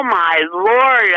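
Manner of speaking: ordinary talk, nothing marked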